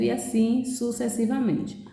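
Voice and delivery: usual speaking voice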